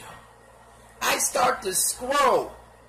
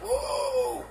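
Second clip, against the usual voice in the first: whoa; 3 dB quieter than usual. Retold or imitated. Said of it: "I was like".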